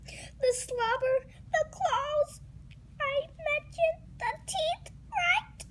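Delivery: high tearful fretting; panicky whine. Acted. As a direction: squeal each scary detail in dismay. Pigeon panics over huge dog.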